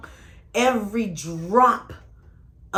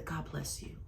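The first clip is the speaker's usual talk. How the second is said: nice blessing; 14 dB quieter than usual. Shown as a demonstration. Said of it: "You gotta do that nice God bless".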